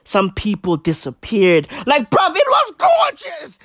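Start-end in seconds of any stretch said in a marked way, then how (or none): 1.9-3.6 much higher, shrieking gorgeous; ecstatic shout. Acted. Comedian praises the chaotic weekend, ecstatic shriek. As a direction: burst out in mock rapture.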